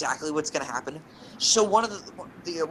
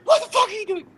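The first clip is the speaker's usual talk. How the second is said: very high, loud imitated mom scream; 9 dB louder than usual. Mom trying to scare the kids, mock scream. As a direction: screech it to scare them, without real anger. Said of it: "and she screams".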